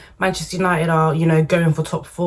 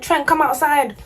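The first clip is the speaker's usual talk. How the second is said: mock fan call-out; 8 semitones higher than usual. Mock fans calling out.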